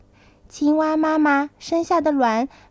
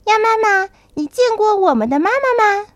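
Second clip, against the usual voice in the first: put-on high, loud childlike question; eager little tadpoles; 9 semitones higher, 6 dB louder than usual. A narrator's reading. Tadpoles swim up to Mother Duck to ask, high and eager. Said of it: "他们一起游到鸭妈妈身边， 问".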